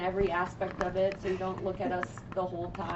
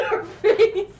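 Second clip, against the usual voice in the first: loud laughing outburst; 14 semitones higher, 9 dB louder than usual. Real, not acted. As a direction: laugh it out, higher and louder. Laughing at dare reaction.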